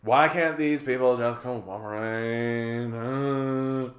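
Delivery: put-on slow, drawn-out Valley Girl groan; hammed-up weary annoyance; eye-rolling exasperation. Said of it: "rolling her eyes and just being like".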